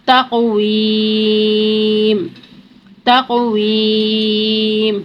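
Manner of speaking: slow chanted tajweed recitation; Quran recitation. Shown as a demonstration.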